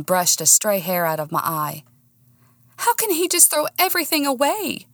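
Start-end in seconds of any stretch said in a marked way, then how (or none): 2.8-4.9 high-pitched, hurt appeal; upset protest. A narrator's reading. Woman blinking back tears to Brandon, hurt. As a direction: plead for an answer, pitch raised. Tearful heroine appeals to friend.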